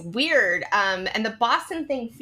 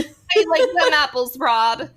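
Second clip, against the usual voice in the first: badly faked Boston accent, pitched up and loud; loud, hopeless attempt at Boston speech; failed Boston accent; 6 semitones higher, 5 dB louder, slower than usual. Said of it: "I can't do a Boston accent".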